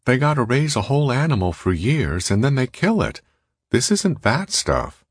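high-pitched lecturing woman's voice; high, swooping character voice, slowing at the end; Molly. A narrator's reading. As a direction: lilt it high, scolding him over waste. Molly scolds him over costly meat.

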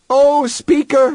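high, urgent cry. A narrator's reading. Human throws himself before Speaker, crying out. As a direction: cry out high to get his attention. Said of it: "he cried loudly".

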